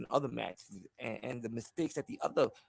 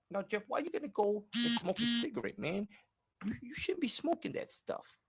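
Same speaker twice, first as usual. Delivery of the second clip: pitched way up, lisping scold; high Tyson-style voice; 12 semitones higher than usual. Acted. To Jeff about smoking, a squeaky high scolding voice.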